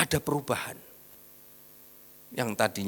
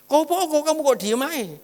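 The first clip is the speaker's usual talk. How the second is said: high, loud nagging wife voice; 7 dB louder than usual. Retold or imitated. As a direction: play the wife pestering him about his silence. Wife nags on the drive. (istri yang mengomel dengan suara tinggi dan keras)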